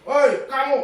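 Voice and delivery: a punisher barking at a pupil; high shouting call. Shown as a demonstration. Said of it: "Nada suaranya tinggi". (teriakan keras bernada tinggi)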